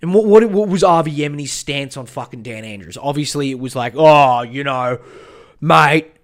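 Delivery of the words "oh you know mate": loud, drawn-out mate-and-bro impression; discarded mock voice. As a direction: put on a loud blokey act. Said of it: "he doesn't talk like that".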